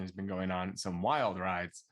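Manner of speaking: ordinary delivery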